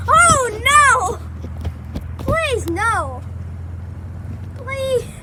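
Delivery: loud frightened pleading; panicked pleading. Real, not acted. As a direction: beg in real panic. Panicking while tubing downhill.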